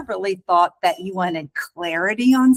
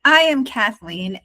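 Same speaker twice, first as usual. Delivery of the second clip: ordinary talk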